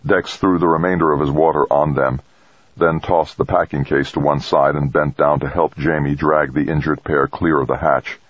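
ordinary delivery

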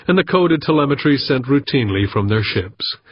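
usual speaking voice

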